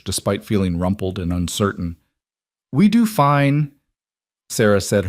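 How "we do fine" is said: put-on slow, higher-pitched Wyoming accent. A narrator's reading. Sarah answers the new teacher's greeting, slow Wyoming accent. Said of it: "her accent pure Wyoming".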